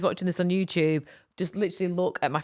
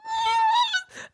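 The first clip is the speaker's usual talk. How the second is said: sudden high cry of pain, near tears; pained yelp. Retold or imitated. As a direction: shriek as the door slams on the finger. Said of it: "holding back the tears".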